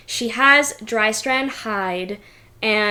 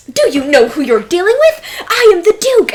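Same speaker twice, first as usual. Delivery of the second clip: fast, loud, higher outraged yell; indignant shout; 8 semitones higher, 9 dB louder, faster than usual. Acted. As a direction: bellow his rank as he's dragged out. Duke dragged away shouting.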